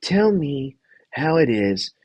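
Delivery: lightly put-on host, drawing the question out; Jeff. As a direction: probe slowly like a TV host. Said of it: "And Jeff's like".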